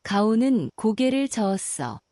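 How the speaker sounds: plain reading voice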